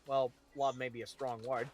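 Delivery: ordinary delivery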